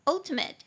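ultimate with the weight on UL; stress on first syllable. Shown as a demonstration. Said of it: "重音节在第一音节".

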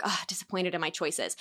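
soft, sighing self-reproach; mock inner groan. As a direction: grumble it quietly to yourself. Voicing inner self-talk.

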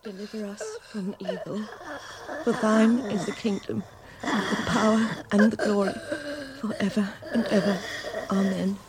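soft murmured prayer; quiet prayer. Acted. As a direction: pray under your breath at the bedside. Mother praying over sick child.

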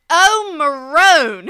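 put-on loud, slow curse in a Southern accent; Southern-accented Italian curse. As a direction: wail the Italian slur with Southern vowels. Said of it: "I do it in my accent".

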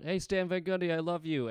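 mild imitation of a shouted greeting; retold fan scream. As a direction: call out like an adoring fan, held back. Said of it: "somebody screamed out".

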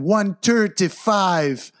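put-on pumped-up Jamaican accent intro. Podcast host greeting listeners in a playful Jamaican accent. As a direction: hype it up with a Jamaican lilt. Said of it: "in my Jamaican voice".

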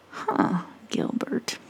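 hushed, breathy realisation; whisper. Acted. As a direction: say it under your breath. Soft realisation.

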